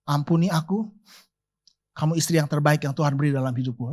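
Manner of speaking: soft, low words of apology to his wife; soft and quick, like pleading for forgiveness; husband softly asking his wife's forgiveness; tender apology. Retold or imitated. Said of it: "Saya tatap mata dia, saya ngomong gini".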